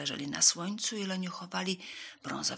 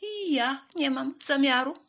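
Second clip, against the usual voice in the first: high, loud mouse; loud, high mouse refusal; called-out refusal; 8 semitones higher, 7 dB louder than usual. A narrator's reading. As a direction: call it out high as the storybook mouse. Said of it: "zawołała szara mysz".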